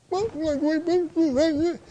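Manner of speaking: put-on high-pitched babyish stammer; whining twenty-three-year-old. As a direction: grown worker whimpering to the boss like a toddler. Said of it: "how would that look on a twenty-three-year-old".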